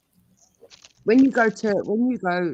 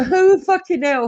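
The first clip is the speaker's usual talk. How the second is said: shocked outburst; 10 semitones higher, 6 dB louder, slower than usual. Real, not acted. Shocked outburst at friend's claim.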